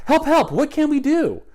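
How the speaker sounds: high, loud cry for help; panicked cry. Acted. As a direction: call out in panic. Everybody panicked.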